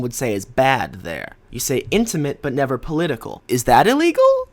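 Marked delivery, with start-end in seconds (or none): 3.5-4.5 high, loud mock disbelief; incredulous. Real, not acted. To the critic, a loud incredulous question. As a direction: ask it as if shocked.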